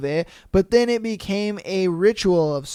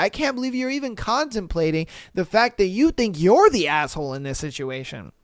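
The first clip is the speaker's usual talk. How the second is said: ordinary talk, nothing marked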